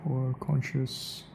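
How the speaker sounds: ordinary delivery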